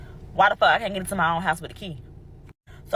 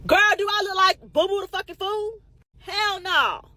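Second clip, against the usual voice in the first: high, mocking disbelief, pitched way up; sharp, high-pitched attitude with a sting; sassy comeback; 13 semitones higher, 4 dB louder than usual. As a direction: scoff at her like she's crazy. Retorting to the lady's request.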